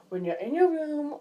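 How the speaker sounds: normal talking voice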